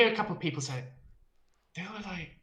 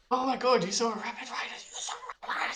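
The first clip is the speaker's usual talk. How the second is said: excited fan; 8 semitones higher than usual. Retold or imitated. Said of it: "they were saying".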